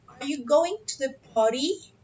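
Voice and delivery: "party?" lifted at the end; rising question intonation. Shown as a demonstration. Teacher models a yes-or-no question, voice rising on "party". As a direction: let the pitch climb on "party?". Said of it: "la entonación de nuestra pregunta sube".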